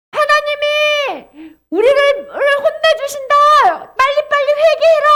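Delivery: high, full-voiced warning shout; loud proclamation. Shown as a demonstration. Watchman urging people to repent, high loud shout. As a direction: cry it out to everyone. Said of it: "하고 크게 외쳐야 돼".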